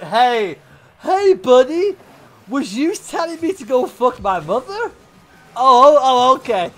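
loud, exaggerated polite challenge; mock confrontation. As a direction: call out in hammed-up indignation. Acted sarcastic confrontation, hypothetical.

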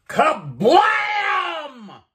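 huge high shouted boom, drawn out; loud hollered explosion noise; imitated explosion. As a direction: burst out with a cartoon blast. Storyteller imitates the grenade exploding.

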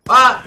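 loud, high startled "ah"; startled cry. Real, not acted. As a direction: cry out as you're caught. Killed suddenly in game.

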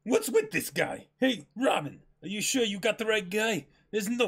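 can't believe this guy; incredulous Usopp. Acted. Usopp complains to Robin in disbelief.

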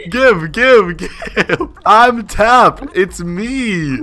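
loud, high begging; clamor for what should be yours; pleading demand. Real, not acted. Begging for the gifts meant for him.

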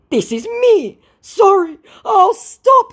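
very high, loud mock whine; hammed-up desperate apology; pleading scammer. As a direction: plead in a squeaky put-on voice. Voices scammer's pleading message.

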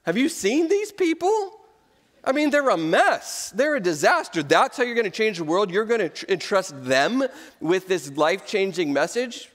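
mock disbelief, pitch jumping up on the questions; incredulous God. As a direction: play an incredulous God, stretching the key words. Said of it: "if I were God, I would just look at it and go".

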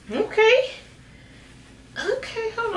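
high squeaky okay from effort; strained squeak. Real, not acted. Vlogger tugging tight tights up, strained high squeak.

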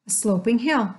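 plain reading voice

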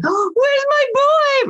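put-on very high, slow doggy baby talk. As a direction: coo it up high and drawn out like to a puppy. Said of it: "which is like".

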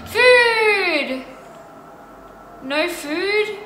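high, long wail for food; mournful cry. Real, not acted. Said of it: "I'm so sad right now".

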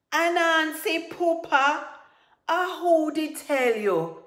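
high, very loud shouted question; outraged demand. Acted. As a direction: demand it at full volume, slowing at the end. Tiger's outrage in acted quarrel.

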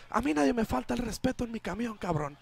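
high, fast indignant bus driver's outburst; retold yell. Bus driver standing up to the gunman, acted yell. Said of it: "suddenly yells".

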